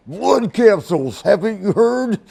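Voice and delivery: high, loud wrestler impression; Randy Savage. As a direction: stretch the first words, belting it out. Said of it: "He goes".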